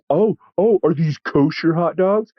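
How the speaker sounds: high, sneering put-on voice with a fake-innocent question; mocking Nazi streamer. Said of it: "I'm not even doing an impression".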